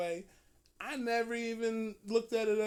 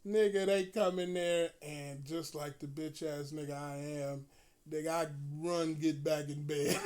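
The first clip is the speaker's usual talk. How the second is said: ordinary talk, nothing marked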